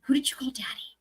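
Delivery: hushed teasing whisper; unvoiced conspiratorial question. Real, not acted. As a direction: breathe it like a secret. Teasing aside to chat.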